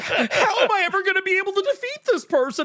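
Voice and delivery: high-pitched, hammed-up helpless wail; mock whining. Imitating a whiny protagonist facing defeat, high mock whine. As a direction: wail it high like a hopeless hero.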